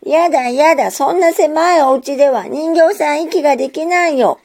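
put-on high, loud whiny child protest; wailing child protest. A narrator's reading. Girl protests to her mother about the cramped drawer, loud wailing. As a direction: cry out against it like a stubborn little girl. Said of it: "大きな声で泣きわきめきます".

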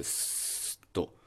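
スーッと held as a soft voiceless hiss; voiceless s demo. Shown as a demonstration. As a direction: let air hiss out with no voice. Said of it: "声帯が震えることなく". (無声の「スーッ」を息で伸ばす)

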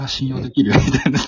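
高く大きな声で、おどけて感心; ファンとして大げさに太鼓判を押す; ふざけた感心. Retold or imitated. (高めの声で大げさに盛り上がる)

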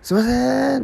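high, drawn-out imploring call; desperate caller. Retold or imitated. Visitor waiting for the resident to answer, pleading call. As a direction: beg for attention through a closed door. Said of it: "必死にこう訴えかけてる".

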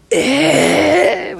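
high, loud "えー" of disbelief; stunned group cry of disbelief; shocked cry. Retold or imitated. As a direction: gasp it out as if the stage just saw the pen. Whole stage shocked by reversed pen.

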